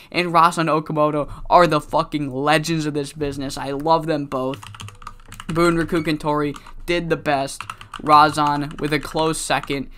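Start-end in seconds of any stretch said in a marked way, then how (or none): none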